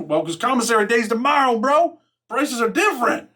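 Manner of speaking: high, sly seller's excuse; store hustler. Retold or imitated. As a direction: pitch it way up like a hustling clerk. Store kid voiced in story.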